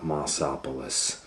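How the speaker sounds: ordinary delivery, nothing marked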